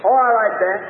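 ordinary delivery

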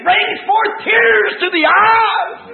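slow, high, drawn-out pulpit cry; climactic preaching shout. Real, not acted. Preacher on the depths that bring tears, climactic shout. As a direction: stretch the words out in a fervent shout.

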